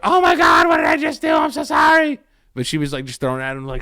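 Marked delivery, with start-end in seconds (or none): 0.0-2.2 much higher, louder panicked apology; mom shouting apology. Retold or imitated. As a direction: shout it horrified at what she did. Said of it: "immediately she was like".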